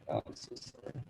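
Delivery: normal talking voice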